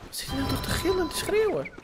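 high, puzzled question; surprised high. Real, not acted. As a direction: sound startled and amused. Reacting to screaming in game. (hoog en verbaasd)

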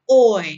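Oi said loudly, round lips opening wide; loud model oi. Shown as a demonstration. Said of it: "bibir Anda itu membuat lingkaran O". (Oi keras dengan bibir bundar)

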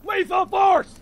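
high frantic yell; panicked shouting. Real, not acted. Panic after shooting teammate.

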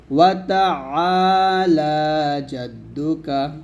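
তাআলা with the ain pressed hard; slow recitation, ain in তাআলা firmly pressed; stressed ain. Shown as a demonstration. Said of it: "আইনটা একটু চাপ দিয়ে বলতে হবে".

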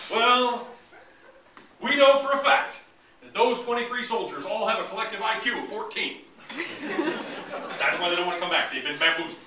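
hammed-up self-important official declaring brainwashing; mock official allegation. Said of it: "all kinds of allegations".

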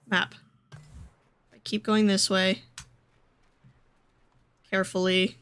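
ordinary delivery

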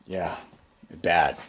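normal talking voice

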